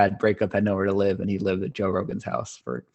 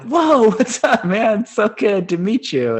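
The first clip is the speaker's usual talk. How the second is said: starstruck excited; 13 semitones higher, 8 dB louder, slower than usual. Retold or imitated. Starstruck greeting to idol.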